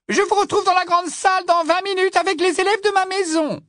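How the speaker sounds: high, loud determined witch; firm witch voice. Acted. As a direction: say it firmly, resolved. Sprout voiced.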